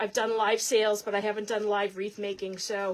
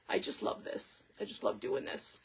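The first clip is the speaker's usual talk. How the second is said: whispering; 7 dB quieter than usual. Real, not acted. Whispered aside after a laugh.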